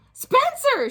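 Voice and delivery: very high, loud scream of his name. Acted. Mom screaming at Spencer over the mess, very high and loud. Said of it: "she screamed".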